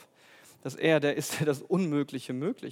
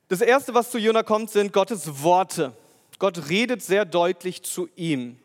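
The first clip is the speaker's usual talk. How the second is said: full-force preaching; 3 semitones higher, 6 dB louder than usual. Acted. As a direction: proclaim it loudly to the congregation. Preacher reads scripture emphatically. (laut und langsam predigend)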